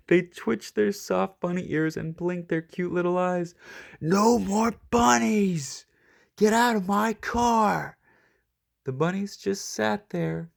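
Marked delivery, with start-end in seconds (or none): 4.0-8.0 1 exasperated Mike. A narrator's reading. Mike shouting at the bunnies.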